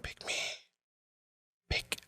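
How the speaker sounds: tiny, barely audible whisper; hushed, pleading whisper; whispered plea. Acted. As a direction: whisper it like a secret wish, with a long pause between. Acting out a silent wish at shows.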